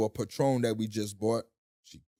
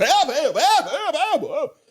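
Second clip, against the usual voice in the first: very high loud mock shrieks, laughing; both spouses screaming for help, hammed up; yelling for help; 14 semitones higher, 6 dB louder, slower than usual. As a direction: shriek it over and over, cracking up. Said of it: "Now we both".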